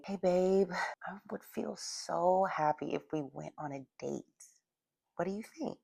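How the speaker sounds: slow and soft, drawing him in. Shown as a demonstration. Coach shows how to ask a man on a date, soft and slow. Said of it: "I'm slowing down and I'm giving him a higher pitch voice".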